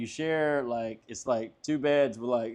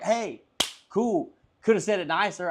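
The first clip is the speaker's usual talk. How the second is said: higher-pitched mock-offended comeback; retold retort; 6 semitones higher than usual. Driver answering the man yelling at him, retold retort. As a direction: push back, pitched up. Retold roadside argument, talking back.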